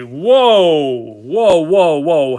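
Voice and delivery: loud, excited repeated whoa; whoa, hold everything; surprised whoa. Real, not acted. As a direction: stop short at the card. Opens a pack, sees rare card.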